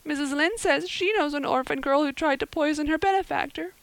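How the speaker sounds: put-on high, wailing, distraught girl's voice; sobbing girl. A narrator's reading. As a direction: pour out the misery in a high acted wail. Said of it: "sobbed Anne".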